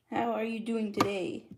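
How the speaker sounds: normal talking voice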